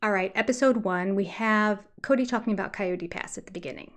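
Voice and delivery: ordinary talk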